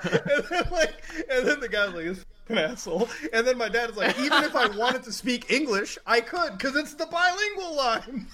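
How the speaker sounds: high, giggling retelling; laughing through the story. Real, not acted. Friends hear dad's border story, told laughing. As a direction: laugh while telling it.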